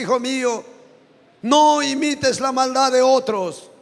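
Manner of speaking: forceful pulpit preaching, building; preaching at full force. Acted. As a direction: preach it out to the whole congregation. (predicación con toda la fuerza)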